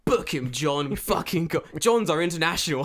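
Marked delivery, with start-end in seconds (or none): 0.0-0.3 mock detective. Mock detective solving case.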